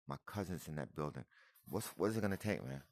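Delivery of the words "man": ordinary talk, nothing marked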